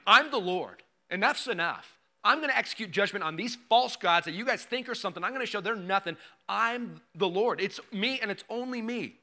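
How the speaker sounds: booming commanding voice of God; God's stern dad tone. Retold or imitated. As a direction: declare it with full authority, no arguing. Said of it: "God kinda has that tone right here".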